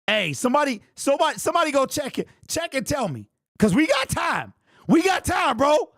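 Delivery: high, fast, shouting at the chat; excited shout. Real, not acted. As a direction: hype up the chat, yelling. Urging chat to check commenter.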